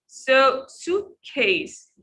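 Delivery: suitcase drawn out slowly, SUIT stressed; slow demo of suitcase. Shown as a demonstration. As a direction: stretch out suitcase, lean on SUIT. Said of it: "We stress in the first part".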